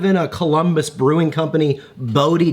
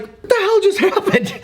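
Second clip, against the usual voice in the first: baffled laugh. Retold or imitated. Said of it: "And I was like".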